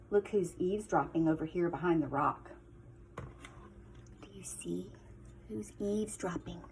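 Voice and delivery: soft, low whisper pointing at the picture; hushed aside. Real, not acted. Teacher quietly pointing out the eavesdropper to children. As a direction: lean in and whisper to the class.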